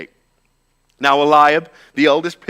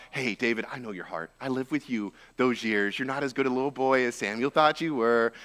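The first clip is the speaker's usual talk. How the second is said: sneering murmur; 6 dB quieter than usual. Acted. Eliab quietly taunts his little brother, sneering murmur. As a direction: lean in and taunt him quietly. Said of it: "And Eliab goes".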